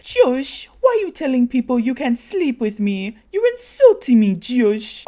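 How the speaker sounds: high, loud accusing rant; going off. Retold or imitated. Natalie accusing Josh in front of people, high loud voice. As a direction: scold him in a high loud voice. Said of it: "starts going off".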